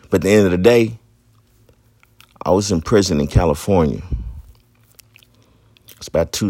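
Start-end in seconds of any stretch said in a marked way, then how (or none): none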